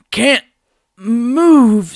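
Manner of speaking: high, loud strained cry; paralyzed leader crying out in alarm. A narrator's reading. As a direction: push against frozen limbs.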